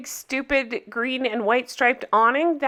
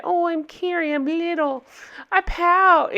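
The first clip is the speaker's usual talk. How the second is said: mocking pouty Carrie; 7 semitones higher than usual. Mocking Carrie's pouting to her viewers, in a high whiny voice. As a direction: mimic Carrie as a sulky little girl. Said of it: "She's just being all pouty Carrie".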